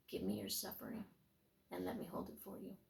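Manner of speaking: a hint of Jesus soothing his daughter; tender Jesus voice. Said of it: "He said, "My daughter".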